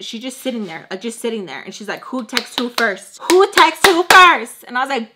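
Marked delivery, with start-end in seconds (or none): 3.3-4.6 very loud, high, drawn-out question; shouted demand. Retold or imitated. Roommate demanding to know who texts first, shouting. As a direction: shout it slowly, demanding an answer.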